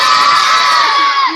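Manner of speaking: very high, full loud yell; big shout. Shown as a demonstration. Storyteller leads the children in a loud high shout. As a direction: belt it out to lead the crowd. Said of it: "Go really loudly, go on".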